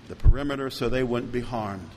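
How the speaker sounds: usual speaking voice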